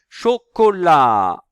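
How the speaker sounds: emphatic, drawn-out classroom pronunciation; syllable by syllable. Shown as a demonstration. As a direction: sound the word out in stretched syllables. Teacher sounding out syllables.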